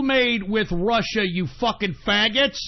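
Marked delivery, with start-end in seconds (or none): none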